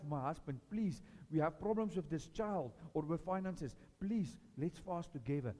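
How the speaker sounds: quiet, earnest plea to fast together; pleading invitation. Retold or imitated. Believer asks spouse and brother to fast, soft pleading. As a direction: gently beg a loved one to join.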